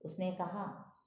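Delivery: ordinary delivery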